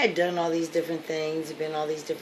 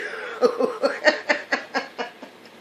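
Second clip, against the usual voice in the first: big laugh; 9 semitones higher, 5 dB louder than usual. Real, not acted. Laughter after punchline.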